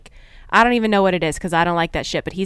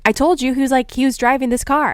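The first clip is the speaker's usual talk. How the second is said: excited told-you-so; 6 semitones higher, 4 dB louder, faster than usual. Retold or imitated. Husband's excited reaction.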